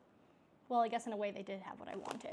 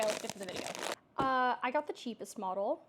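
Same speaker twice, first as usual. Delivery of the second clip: ordinary delivery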